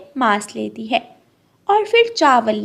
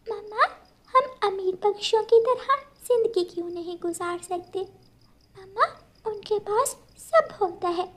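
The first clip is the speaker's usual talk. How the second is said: put-on higher, softer questioning child; wistful little girl; 9 semitones higher, 6 dB quieter than usual. Daughter asking mother why they are poor, higher soft child voice. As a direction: ask mama softly, childlike and wistful.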